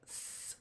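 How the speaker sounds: "S" as a voiceless hiss; quiet sustained sss; soft S. Shown as a demonstration. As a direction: hiss "S" softly, no voice. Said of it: "a soft S sounds like a soft C".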